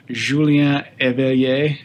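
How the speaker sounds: slow, careful French accent on the name. Shown as a demonstration. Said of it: "Try my French accent".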